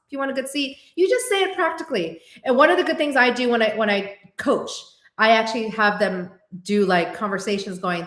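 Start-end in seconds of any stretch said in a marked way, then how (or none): 0.1-2.2 matter-of-fact reply. Retold or imitated. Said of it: "I'm like".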